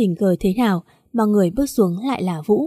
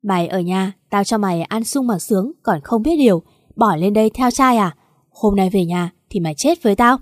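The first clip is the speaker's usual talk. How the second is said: plain reading voice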